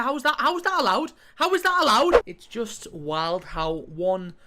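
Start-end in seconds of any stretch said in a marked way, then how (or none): 0.0-2.2 higher, louder incredulous outrage; outraged. Real, not acted. Gamer outraged at the unfair game. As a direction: demand an answer in disbelief.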